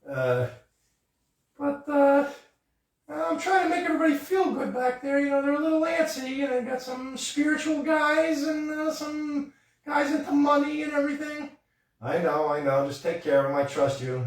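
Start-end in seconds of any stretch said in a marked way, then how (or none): 1.6-11.5 high-pitched frog puppet chatter; squeaky puppet greeting his cousin. Acted. Puppeteer voices Kermit to host, high puppet voice.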